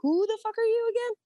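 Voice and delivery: squeaky high disbelieving yelp; mock-incredulous major. Army major asking who he is, shrill incredulous yelp. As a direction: play the baffled officer, voice jumping up high. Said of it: "he was like".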